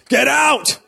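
high, loud outraged cry of get out; shocked shout. Retold or imitated. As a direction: yell it like a shocked crowd. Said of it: "I was expecting".

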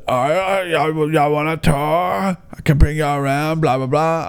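a hint of an old woman calling out an offer; elderly tour guide. Old guide offers visitors a tour, lightly imitated old voice.

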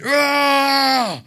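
long high roar; imitated kid's roar. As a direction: roar like an excited kid. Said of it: "He just, he just said".

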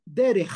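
DERECH ending in a long scraped kh; strong kaf. Shown as a demonstration. Teacher contrasting ora and derech, strong ending. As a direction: rasp the last sound of "derech". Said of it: "que es diferente".

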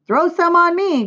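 put-on high, loud boyish plea; crying out. A narrator's reading. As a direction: play an eager boy shouting. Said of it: "cried Avery".

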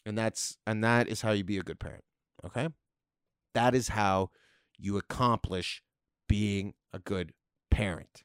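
low, flat sarcastic pronouncement; deadpan it, dropping low. Real, not acted. Comic sarcastically telling parents how to parent, low and deadpan. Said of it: "Can you tell that's sarcastic?".

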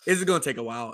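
ordinary delivery

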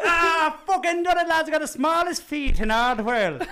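high, loud mock-drunk victory cry; triumphant shout. Second dwarf back a winner, hammed-up triumphant shout. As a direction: crow it to all the lads.

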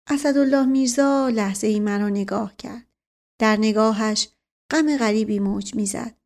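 usual speaking voice

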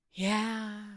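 sighing, half-hearted yeah; deflated. Retold or imitated. People saying their goals with a big sigh, deflated. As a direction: let the air out of it. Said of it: "they have this big sigh".

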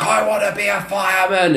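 put-on high, excited little boy; toddler voice. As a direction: chirp it like a two-year-old. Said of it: "he opened each of us just like".

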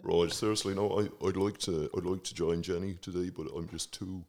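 lightly voiced [name], low and soft; a hint of [name], subdued; [name] impression. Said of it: "Sir Bob".

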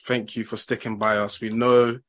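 usual speaking voice

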